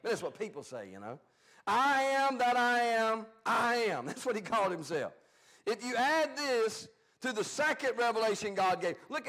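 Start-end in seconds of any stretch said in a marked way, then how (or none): 1.7-4.1 slow, weighty, each word given its own space; solemn proclamation. Acted. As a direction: let the divine name ring out. Solemn divine name pronounced.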